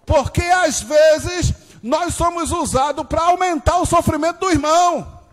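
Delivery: high, loud, shouted sermon; fiery preaching. Acted. Preaching at full force.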